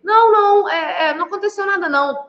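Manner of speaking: high-pitched, flustered denial; mock fearful denial. Wife caught out about coming home late, acted fear. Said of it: "aí você vê uma expressão de medo".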